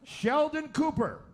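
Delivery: normal talking voice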